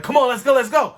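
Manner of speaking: put-on an eager mother rushing the kids; urgent hurry. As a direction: come on, we have to move now. Mom urging them to go.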